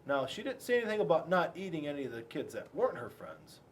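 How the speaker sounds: ordinary delivery, nothing marked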